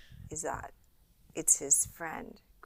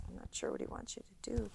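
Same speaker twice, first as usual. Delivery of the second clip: whisper; 11 dB quieter, much faster than usual. Acted. Spooky aside in the dream story.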